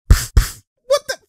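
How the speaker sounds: high, loud disbelieving cry; shocked. Real, not acted. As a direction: jolt up in pitch, can't believe the play. Shocked by a play on screen.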